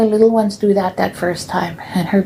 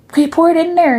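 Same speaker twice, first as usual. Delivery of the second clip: nun's demand; 9 semitones higher, 3 dB louder, faster than usual. Retold or imitated. Nun ordering child.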